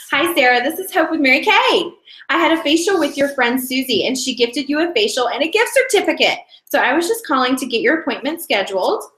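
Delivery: upbeat, fluent sales call; practiced, cheery caller with lifted pitch; flowy phone script. Shown as a demonstration. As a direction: deliver the script brightly without hesitating. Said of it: "the reason I sound so flowy".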